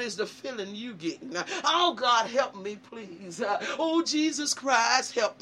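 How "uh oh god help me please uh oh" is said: normal talking voice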